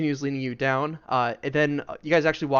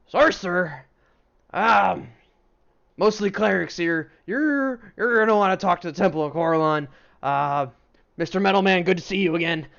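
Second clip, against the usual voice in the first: loud, higher dwarf craftsman voice; hearty dwarf; 5 semitones higher, 6 dB louder than usual. Acted. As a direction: boom it out as a busy dwarf. Dwarven fellow answers visitors.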